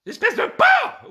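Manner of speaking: long, very high, loud shriek; outraged woman. Acted. Woman insulting man before the officer, outraged shriek. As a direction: draw the insult out in falsetto.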